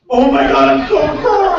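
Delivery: high, loud mock squeal; mortified drunk fan. Drunk fan realizes who he met, squealing imitation. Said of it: "he goes from, like, cocky to".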